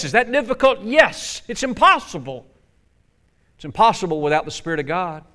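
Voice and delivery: ordinary talk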